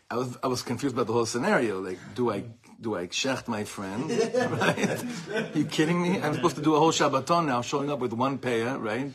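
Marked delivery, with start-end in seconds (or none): 4.1-9.1 laughing disbelief. Retold or imitated. Exasperated retelling, laughing.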